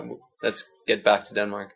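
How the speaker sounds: ordinary delivery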